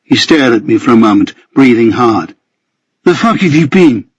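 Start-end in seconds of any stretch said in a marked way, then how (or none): 3.0-4.1 higher-pitched angry demand. A narrator's reading. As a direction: demand it with restrained anger, pitched up. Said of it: "partly from anger".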